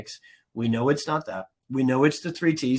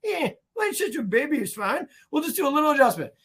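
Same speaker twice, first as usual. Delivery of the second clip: mocking hippie chiropractor; 11 semitones higher than usual. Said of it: "And we're like".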